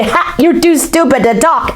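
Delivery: mock him cruelly; taunting. Kids taunt Morgan, high and sneering.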